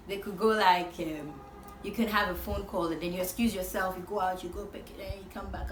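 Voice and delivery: normal talking voice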